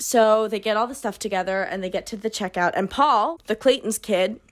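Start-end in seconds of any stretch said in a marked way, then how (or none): none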